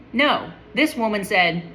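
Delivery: normal talking voice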